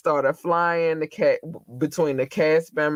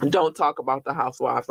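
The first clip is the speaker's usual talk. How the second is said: usual speaking voice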